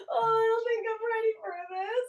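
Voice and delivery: high, whimpery fake crying; hammed-up tearful dread; mock crying Kaylee. Co-host imitating Kaylee's nervous line, mock crying. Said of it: "She's like".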